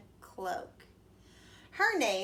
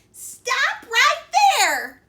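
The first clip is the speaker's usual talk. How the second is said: very high, very loud, slow yell; 14 dB louder, much slower than usual. A narrator's reading. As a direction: yell to stop him. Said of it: "yelled Granny".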